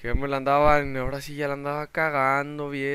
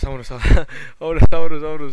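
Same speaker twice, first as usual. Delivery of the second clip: loud laughing words; 14 dB louder, much faster than usual. Real, not acted. Gamer escaping mobs, laughing loudly. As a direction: laugh through the words while running off.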